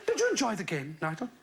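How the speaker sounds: put-on lightly posh English accent, father and son; a hint of plummy English, a vicar voicing his boy. Radio vicar retells a chat with his son, light posh English accent. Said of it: "Pretty good English accent".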